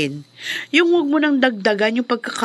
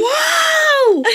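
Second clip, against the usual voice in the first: amazed shout. Acted. Sheila hears about Danding's gift to Pinky, high amazed shout. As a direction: burst out in amazement.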